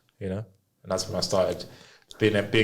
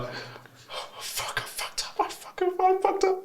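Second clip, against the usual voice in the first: panicked mutter; 6 dB quieter, slower than usual. Retold or imitated. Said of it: "I was like".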